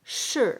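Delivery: "shi" with a sharp falling fourth tone. Shown as a demonstration. Teacher to students modelling the tone, falling shi. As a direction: drop the pitch hard on "shi". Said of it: "because the fourth tone".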